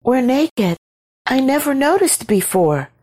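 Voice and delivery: high, hushed woman's voice; mildly startled, high-pitched; whispered. A narrator's reading. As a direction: confide in a high, uneasy voice. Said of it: "she whispered".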